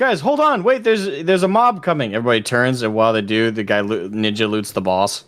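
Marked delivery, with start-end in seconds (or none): 0.0-2.1 high-pitched imitation of an alarmed gamer; urgent mock warning. Player warning his group of a mob, urgent mock call. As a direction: call out a pretend alarm to the party.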